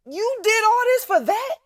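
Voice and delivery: high, loud disbelief; mock outrage at a tiny dog; incredulous. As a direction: mom scolding daughter over the little dog. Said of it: "I'm like".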